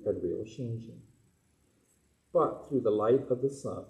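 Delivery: low, measured reading of scripture; solemn scripture reading. Acted. Minister reads Shinran Shonin's words to the adults, low and measured. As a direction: read it slowly with reverence.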